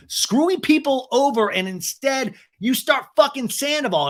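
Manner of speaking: high-pitched retold outburst; heated accusation. Lala accusing a castmate, acted high and indignant. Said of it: "So Lala goes".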